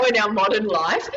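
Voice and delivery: usual speaking voice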